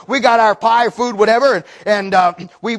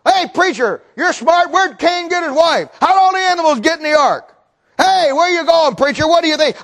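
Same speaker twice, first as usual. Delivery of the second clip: higher, insistent mock challenge; heckling taunt; 7 semitones higher than usual. Heckler challenging the preacher, taunting. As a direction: pepper him with jeering questions.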